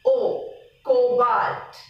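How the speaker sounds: slow cobalt, O held long; stretched O. Shown as a demonstration. Teacher showing the emphasized letter after C, stretched O. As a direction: say O, then cobalt slowly with a long O. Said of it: "You stretch the O".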